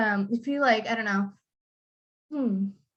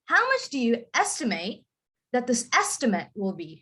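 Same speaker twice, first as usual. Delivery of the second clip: verb "estimate" ending in a rising full "ate", noun "estimate" front-stressed; noun-verb stress contrast. Shown as a demonstration. Teacher showing a student how to say the sentence, noun-verb stress contrast. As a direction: model "estimate" as verb with stressed end, then as noun with stressed start. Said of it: "So you would say this as".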